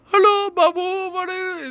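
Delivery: very high, loud cartoon Indian accent; exaggerated high-pitched Indian caricature accent; Apu. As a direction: squeal it in a Simpsons-style Indian accent. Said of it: "It's like Apu, you know, like Simpsons Indian".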